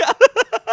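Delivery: very high, loud cackle; loud acted cackle. Co-host acting out "you're like", loud high cackle. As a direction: cackle loudly at a high pitch.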